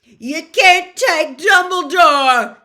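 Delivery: very loud, slow desperate yell. Acted. Hagrid yells at Malfoy to stop the removal. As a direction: shout it across the room. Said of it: "yelled Hagrid".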